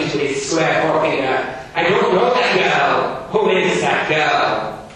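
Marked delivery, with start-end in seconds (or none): 1.7-4.9 loud, high, stern schoolmaster. Acted. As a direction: bark it like a strict Victorian teacher. Gradgrind voiced in reading.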